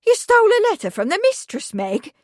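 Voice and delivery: high, loud, fast shocked gasp; horrified. A narrator's reading. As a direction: blurt it in horror. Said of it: "stiffened, horrified".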